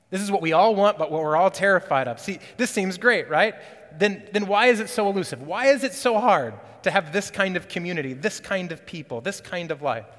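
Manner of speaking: loud, high, urgent sermon climax; preaching at full force. Acted. Pastor pressing the church why community is hard, loud. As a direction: press the question hard on the congregation.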